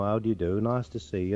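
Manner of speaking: everyday Yorkshire greeting in dialect; Yorkshire accent. Shown as a demonstration. Comedian showing the host how Yorkshire people greet, Yorkshire accent. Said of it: "you get the Yorkshire people".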